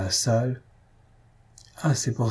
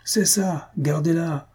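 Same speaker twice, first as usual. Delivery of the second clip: higher, teasing call from the crowd; amused crowd jeer; 6 semitones higher, slower than usual. Acted. The amused audience teasing Maroquin, mock approval. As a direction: egg him on mockingly. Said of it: "cria le public".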